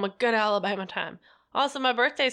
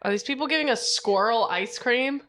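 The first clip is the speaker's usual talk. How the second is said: ordinary talk, nothing marked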